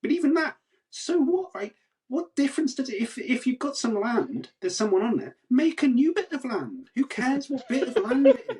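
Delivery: way up high and loud, baffled indignation; high, loud, disbelieving outburst; incredulous exasperation. Real, not acted. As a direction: throw up your hands at the absurdity. Peak of exasperated rant.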